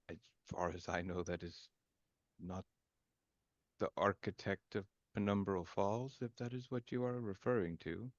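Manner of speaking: low, very soft measured voice; confused formal character. Acted. Confused character answers a player about the architect, quiet and formal. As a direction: speak softly and low, choosing words with care.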